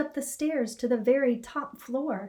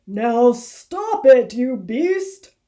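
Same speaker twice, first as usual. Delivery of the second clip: a slow, booming yell, much louder and drawn out; angry farmer shouting; 13 dB louder, much slower than usual. A narrator's reading. Farmer yelling at the rabbit, a slow booming shout. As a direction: bellow at the beast to stop. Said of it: "he heard someone shouting".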